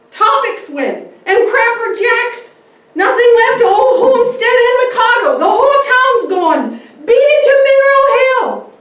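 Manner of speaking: frantic high shouted warning; terrified boy screaming at the top of his lungs; scared yelling boy. Acted. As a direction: scream the news in panic as you ride past. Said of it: "He's scared".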